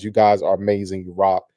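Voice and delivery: ordinary delivery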